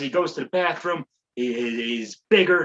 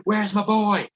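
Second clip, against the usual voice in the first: mock panic; 7 semitones higher than usual. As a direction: ham up a panicked mom with a knife. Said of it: "she's freaking out".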